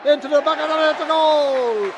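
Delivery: high excited goal call; long goal yell. Real, not acted. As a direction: lift and roar the goal. Second goal in live commentary.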